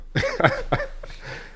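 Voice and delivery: high laugh rising at the end; rising burst of laughter; amused laugh. Real, not acted. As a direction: crack up at the mix-up. Laughing at the pho mix-up.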